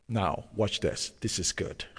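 breathy hushed whisper, leaning in; whispered aside. Real, not acted. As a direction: whisper it like a secret worth hearing. Preacher confides to congregation.

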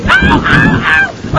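squealing ow ow ow, very high and loud; high-pitched ow screams. Retold or imitated. Boy on jet ski yelps in the rain, high squeals. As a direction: scream like a little girl getting pelted. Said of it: "screaming like little girls".

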